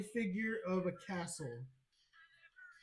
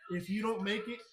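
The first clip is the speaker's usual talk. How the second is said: usual speaking voice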